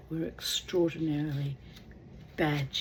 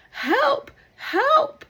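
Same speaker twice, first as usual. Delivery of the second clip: high, loud cries for help; desperate cry; 10 dB louder than usual. Acted. Drowning man calling to the shore for help. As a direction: yelp it up high, panicking.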